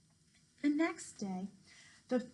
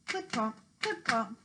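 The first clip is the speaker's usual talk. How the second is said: ordinary delivery, nothing marked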